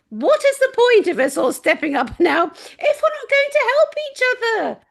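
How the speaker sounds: high, loud half-laughing, half-crying plea; exasperated laughing cry. Real, not acted. Pleading with fellow creators, exasperated laughing cry. Exasperated outburst about selfishness.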